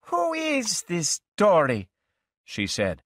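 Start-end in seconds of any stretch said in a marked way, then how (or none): 0.1-1.9 put-on high, slow, wary girl's voice; a girl suddenly suspicious, drawing it out. Girlfriend suspicious of the other woman, voiced high and slow. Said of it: "suspecting something bad".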